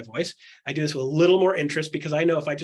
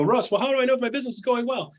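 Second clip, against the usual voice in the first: higher, slightly put-on asking voice; curious client voice; 8 semitones higher, faster than usual. Client asking Russ how business is going, higher put-on voice. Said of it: "People go".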